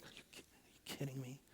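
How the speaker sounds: barely audible, incredulous mutter; hushed disbelief. Retold or imitated. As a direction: say it under your breath, stunned. Acted incredulous muttering to himself.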